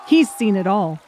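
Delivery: usual speaking voice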